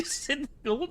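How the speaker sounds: giggling through the words; laughing. Real, not acted. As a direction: break up laughing mid-line. Laughs while reading.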